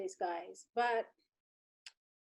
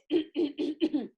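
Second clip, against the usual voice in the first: throat clearing; 2 semitones higher than usual. Shown as a demonstration. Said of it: "clear my throat, throat like this".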